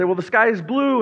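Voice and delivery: a lightly voiced know-it-all; obvious reply, pitch up; smug neighbour. Neighbour answering Bartimaeus.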